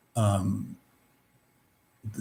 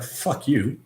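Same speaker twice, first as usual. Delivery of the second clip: inner defiance; 6 dB quieter than usual. Acted. Inner dissonance answering the rule-makers, quietly defiant. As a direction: voice a rebellious thought under your breath. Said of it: "inner dissonance, it's like".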